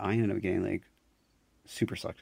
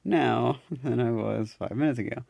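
laughing stressed; 4 semitones higher, 6 dB louder, slower than usual. Retold or imitated. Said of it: "and then I was like".

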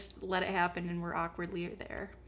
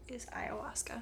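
conspiratorial whisper; 3 semitones higher, 9 dB quieter than usual. Real, not acted. Podcaster letting friend in on a secret, whispered. Whispered punchline of his theory.

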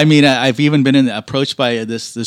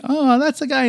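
pitched way up, mock recognising; high mock shop guy; 14 semitones higher than usual. Mechanic imitating coworkers, high mock recognition voice. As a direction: squeak it out like a teasing coworker. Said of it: "They're like".

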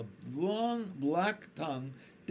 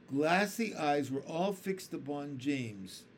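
usual speaking voice

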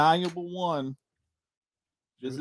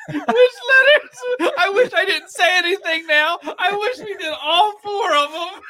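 whiny mock panic; 8 dB louder, slower than usual. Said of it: "we're gonna be like".

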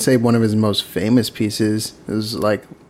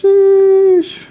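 high squealed sheesh; 8 dB louder than usual. Pretend. Trading exaggerated sheesh calls.

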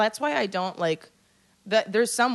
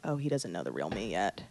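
sly murmur; 11 dB quieter than usual. Retold or imitated. Sly inner voice.

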